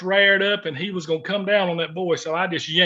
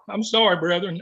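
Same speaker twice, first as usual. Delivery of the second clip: choked up. Real, not acted. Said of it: "trying to get myself together here, guys".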